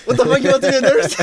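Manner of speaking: high, loud burst of disbelief; incredulous outburst. Real, not acted. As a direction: blurt it out in amused shock. Teasing disbelief at the guest's revelation.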